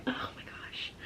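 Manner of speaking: a choked whisper under the breath; overcome, barely voiced; emotional whisper. Real, not acted. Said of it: "I just got super emotional".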